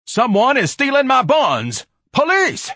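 very high, very loud, slow at first; panicked screaming. A narrator's reading. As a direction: shriek for the police. Ignatius screams about robbery.

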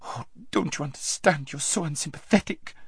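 high, soft, tearful whine; beginning to cry. A narrator's reading. Girl reproaching her friend, tearful. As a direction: whimper it, close to tears. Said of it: "beginning to cry".

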